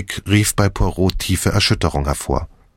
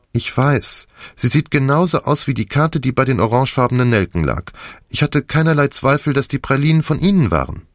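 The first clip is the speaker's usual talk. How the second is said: put-on higher-pitched young woman, explaining insistently; Nick, earnest young patient; 7 semitones higher than usual. A narrator's reading. Nick tells Poirot why she trusted the card, earnest. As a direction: insist you had no reason to doubt.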